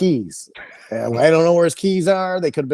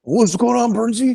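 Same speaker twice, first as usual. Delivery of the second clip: lightly voiced bouncer, pitched up; imitating the bouncer's question; bouncer's greeting; 9 semitones higher than usual. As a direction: toss out a higher-pitched greeting to a buddy. Bouncer voiced in a retold story.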